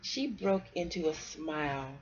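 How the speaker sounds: put-on lively read-aloud for kids; storybook reading. A narrator's reading. Parent reading a bedtime story to kids, lively storybook voice. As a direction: read it like a picture book to children.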